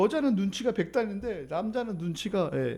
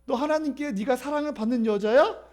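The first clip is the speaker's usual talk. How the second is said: higher-pitched scornful rival; needling taunt; 7 semitones higher, 4 dB louder than usual. Acted. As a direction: needle her like a jealous rival, drawing out the jab. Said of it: "한나의 속을 긁는 거예요".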